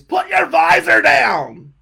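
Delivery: high, drawn-out full-force yell; acted-out shouting mechanic, slow and very loud; screamed order. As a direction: scream the command at the driver. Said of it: "this one guy screams".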